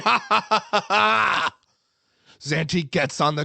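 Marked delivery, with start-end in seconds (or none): 0.0-1.6 loud, high maniacal laughter; hammed-up mad villain laugh; Joker-like laugh. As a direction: cackle uncontrollably like a comic-book villain. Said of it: "laugh like the Joker uncontrollably".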